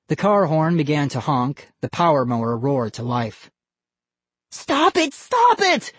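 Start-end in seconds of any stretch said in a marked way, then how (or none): 4.5-5.1 put-on a boy screaming at the dummy. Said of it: "Vinny screamed". 5.1-5.9 even higher, louder repeat; a boy's panicked scream, escalating. Acted. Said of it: "Vinny screamed".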